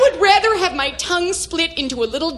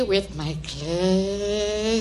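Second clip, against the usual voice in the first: low, slow, last word stretched and stuck; choking on a word; 6 semitones lower, 6 dB quieter, much slower than usual. Acted. Mother quoting daughter, unable to finish the word, straining. Balks at saying the taboo word.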